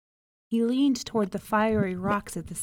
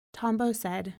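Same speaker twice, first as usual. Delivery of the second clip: usual speaking voice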